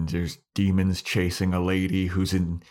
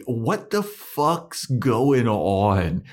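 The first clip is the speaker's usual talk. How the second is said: hammed-up slow, drawn-out disbelief, pitched up; mock bewilderment; 5 semitones higher, 3 dB louder, much slower than usual. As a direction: stretch out every word in exaggerated confusion. Acting out Buffy's bewilderment.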